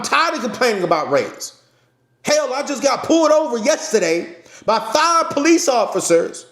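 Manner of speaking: loud, high fired-up sermon delivery; loud passionate street preacher; passionate preaching. Real, not acted. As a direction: preach it at full force to your followers. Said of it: "I ain't yelling. I'm just passionate".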